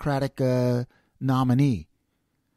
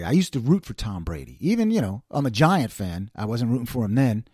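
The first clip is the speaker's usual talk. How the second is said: ordinary delivery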